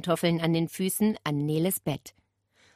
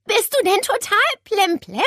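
put-on higher, louder cross girl's voice; annoyed girl; 14 semitones higher, 5 dB louder than usual. Nele scolding the parrot, annoyed girl's voice. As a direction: scold the parrot like a fed-up kid. Nele's voice continues.

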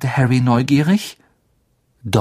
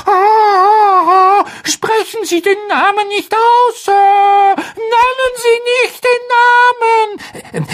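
very high, loud, wailing elf plea; groaning in dread; 5 dB louder, slower than usual. Acted. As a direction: beg in anguish not to say it. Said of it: "und stöhnte".

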